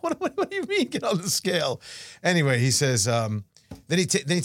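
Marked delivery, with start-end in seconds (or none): 0.0-1.8 high, stammered what-do-you-mean; mock incredulity at the doctor; incredulous protest. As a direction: sputter in disbelief, pitched up. Speaker's indignant retort retold.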